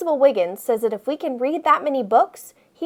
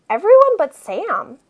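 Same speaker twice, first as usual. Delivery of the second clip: plain reading voice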